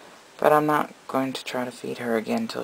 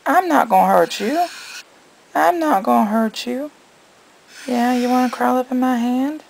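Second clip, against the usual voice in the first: high-pitched cooing to a little animal; 10 semitones higher than usual. Real, not acted. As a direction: coax gently in a high sing-song. Coaxing a pet baby snake.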